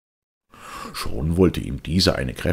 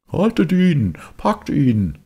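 high, loud shout after a runaway; 13 semitones higher, 6 dB louder, slower than usual. A narrator's reading. Father Seppel yelling after the runaway puppet, loud shout. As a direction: yell for someone to grab him. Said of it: "schrie Vater Seppel".

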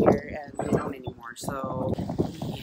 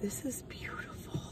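whispered in awe, much softer; awed whisper; 6 semitones higher, 12 dB quieter than usual. Real, not acted. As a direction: breathe it out, stunned by the gift. Awed by the gift.

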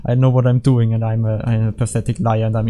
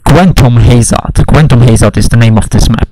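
odd booming announcement; 11 dB louder than usual. Pretend. Player announcing the map's name, odd booming voice. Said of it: "why am I talking like this?".

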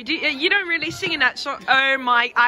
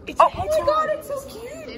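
cooing. Real, not acted. Cooing over the hedgehog.

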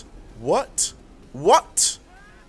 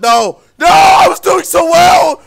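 loud, high wail of defeat; dismayed screaming; 9 semitones higher, 10 dB louder than usual. Real, not acted. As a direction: scream in despair at losing. Dies in game while doing well.

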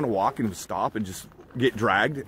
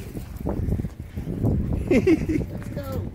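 high giggling laugh; delighted laugh. Real, not acted. Laughing at dogs.

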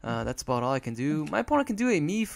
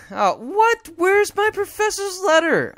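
squeaky, much higher outraged question; slow incredulous whine; high shocked disbelief; 7 dB louder, slower than usual. Real, not acted. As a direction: voice jumps up in dismay. Missing card.

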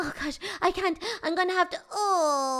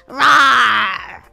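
big pretend roar, much louder; mock tiger roar; 4 semitones lower, 14 dB louder than usual. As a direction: roar at the tiger like a playful kid.

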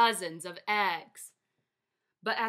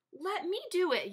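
half-yelled, much higher plea; mild storybook yell; 9 semitones higher than usual. A narrator's reading. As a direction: pipe up as if yelling. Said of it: "yelled Frog".

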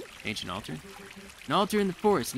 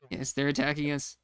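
ordinary delivery

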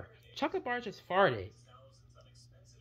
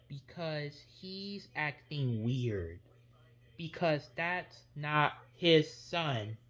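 slow explanation; 4 semitones lower, much slower than usual. Acted.

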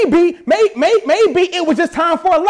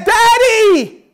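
put-on high, loud child's call; child calling Daddy; 8 semitones higher, 4 dB louder than usual. Preacher voicing his son calling for his father, high and loud. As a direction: call out like a needy little boy. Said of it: "If my son ever needs something, he says".

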